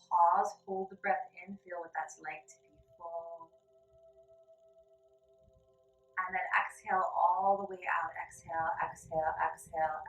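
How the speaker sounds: calm, even guided breath instructions. Acted. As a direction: lead them gently through each breath. Guided breathing in yoga lesson.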